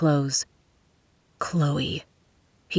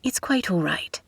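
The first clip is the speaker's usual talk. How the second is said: softer, crisp British politeness; crisp British accent; 5 dB quieter than usual. A narrator's reading. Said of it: "she said in a crisp British accent".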